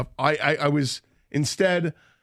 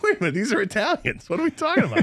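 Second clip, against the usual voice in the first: rising high in disbelief; wait, these are Italians, pitch shooting up; mock outrage at English accents on Italians; incredulous; 7 semitones higher, faster than usual.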